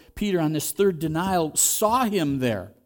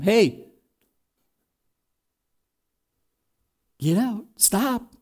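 high, slow and gentle nudge; lightly voiced gentle warning; gentle prodding; 6 semitones higher, much slower than usual. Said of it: "God gently prodding him".

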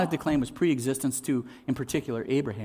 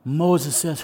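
ordinary delivery

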